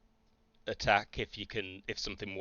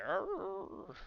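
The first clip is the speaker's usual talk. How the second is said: growling roar; 12 semitones higher than usual. Acted. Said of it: "he's like".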